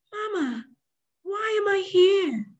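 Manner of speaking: high, small, drawn-out question; timid. Acted. Asabi asks the old woman why she is kept, timidly. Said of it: "She asked in a timid tone".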